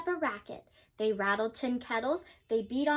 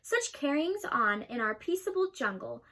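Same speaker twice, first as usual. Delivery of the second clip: plain reading voice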